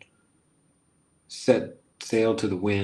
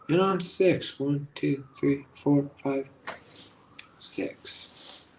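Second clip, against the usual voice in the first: meditation count; 4 semitones higher, much slower than usual. Acted.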